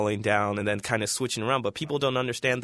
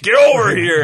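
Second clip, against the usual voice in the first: loud, high Mortal Kombat yell; Scorpion voice; 10 semitones higher, 13 dB louder than usual. Pretend. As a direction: bellow it like the ninja spectre. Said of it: "He's gonna say it in the Scorpion voice".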